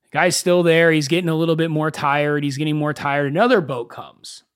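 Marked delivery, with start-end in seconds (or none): none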